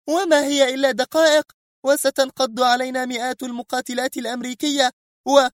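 high, outraged disbelieving cry; Sonia outraged. Acted. Sonia protests in outrage to Mr X, high. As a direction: rage at how much is lost. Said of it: "هتفت بكل سخط الدنيا".

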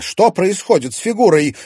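loud, high alarmed question; urgent, flustered worry; a museum director alarmed about the missing figure; agitated. A narrator's reading. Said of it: "разволновалась Алёна Александровна".

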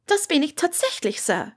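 a polite nervous student; young Hermine. A narrator's reading. Narrator voices Hermine, higher.

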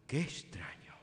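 breathy whisper of unease. Acted. Bishop whispering to the priest, uneasy. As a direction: whisper it, troubled. Bishop reacts to strange news.

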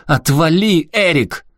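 громкий визгливый ор, намного выше; наори изо всех сил; заорала. Acted. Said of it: "заорала на меня". (Аляска орёт на Эрика у машины)

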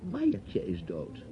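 quiet, deliberate Maiertje is dood; slow soft alternative. Shown as a demonstration. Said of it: "nee, of misschien wel".

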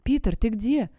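cried out. A narrator's reading. Sarah calls to Peter lost in the woods, loud high call. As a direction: call out urgently to someone lost. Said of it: "выкрикнула я".

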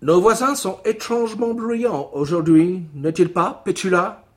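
lightly put-on English accent in French; a hint of an English neighbour speaking French. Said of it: "le voisin avec l'accent anglais".

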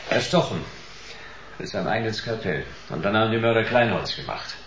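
a cool, clipped crime-story narrator; noir voice-over narration. Acted. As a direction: tell it like a weary private eye.

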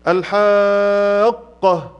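الحاقة with a long, level aa; long obligatory madd. Shown as a demonstration. Teacher listing madd examples, very long aa in الحاقة. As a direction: draw the aa of الحاقة out very slowly before the doubled qaf. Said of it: "وقع بعد حرف المد سكون".

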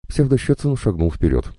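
ordinary delivery

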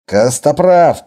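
loud, slow, drawn-out warning; angry growl. Acted. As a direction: roar his name to stop him. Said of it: "прорычал Виктор". (громкий гневный рык)

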